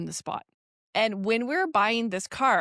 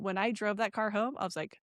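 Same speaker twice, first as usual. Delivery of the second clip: ordinary talk, nothing marked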